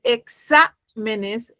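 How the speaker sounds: "exámenes" drawn out, beat held on "xá"; stress on SÁ. Shown as a demonstration. Teacher showing the class the voice beat, "exámenes" slowly with stress on "xá". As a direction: hit "xá" in "exámenes", pause, then finish the word. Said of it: "fíjense el golpe de voz".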